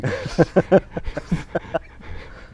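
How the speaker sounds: wheezy silent laugh; breathless laugh. Real, not acted. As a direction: laugh without voice. Laughing at the slip.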